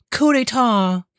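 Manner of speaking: very high imitation of a woman; mimicking woman's voice. Streamer mimicking pronunciation clip, high woman's voice.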